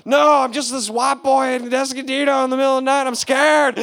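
high, loud scared pleading; plead, playing the frightened kid; hammed-up fear. His own scared pleading.

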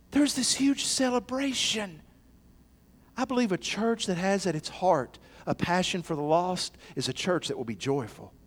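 ordinary talk

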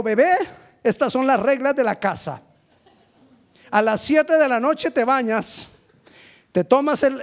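high-pitched laying down of house rules; acted parental rule-giving; mock strict parent. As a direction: lecture a newborn with exaggerated authority. Acts parent reading rules to newborn.

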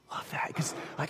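unvoiced hushed aside; a soft whispered aside. Real, not acted. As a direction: breathe it almost silently. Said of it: "whispered aside".

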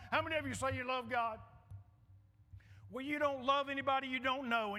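usual speaking voice

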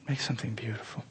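soft, low whispered echo. Real, not acted. Preacher to the church, whispering the promise. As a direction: breathe it out as a whisper.

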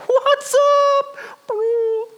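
extremely high squeaky falsetto call; comic falsetto, drawn-out greeting; giddy Peter greeting. Pretend. Mimics Peter's giddy greeting mid-miracle.

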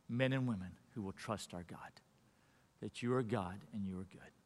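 hushed, earnest prayer. Acted. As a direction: pray softly for the congregation. Pastor leads closing prayer.

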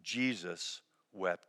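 softly, letting each word fall; a hushed, grave pause between the words; solemn hush. Acted. Pastor reading the shortest verse to grieving listeners, hushed. Refrain answering the question.

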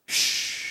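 long, soft "shh"; drawn-out hush; hushing shush. Acted. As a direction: gently shush someone mid-speech. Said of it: "the first thing out of his mouth was".

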